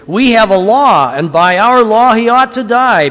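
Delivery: loud, high, declamatory answer; insistent accusation. A narrator's reading. Accusers answer Pilate with a demand.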